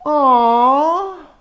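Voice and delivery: high, loud sympathetic aw; a big sad aw for the kids; drawn-out aw. Shown as a demonstration. Teacher leading children in a sad aw. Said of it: "Can we all say".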